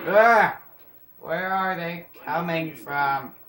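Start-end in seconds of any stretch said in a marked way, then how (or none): 0.1-0.6 loud startled scream; loud yell. Real, not acted. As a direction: yell out as you get killed. Killed again.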